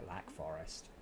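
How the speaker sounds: low, whispered place name; hushed whisper. Pretend. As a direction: whisper it under the breath. Reads game location in hushed voice.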